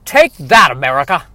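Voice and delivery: hammy action hero bark; movie line. Acted. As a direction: bold trailer hero shouting the line. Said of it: "for the sake of that line right there".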